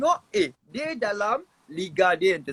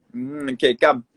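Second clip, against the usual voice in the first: usual speaking voice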